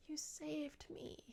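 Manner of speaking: soft, slow whisper of gratitude; breathy whisper. Retold or imitated. Love-struck Rhys voiced in a retelling.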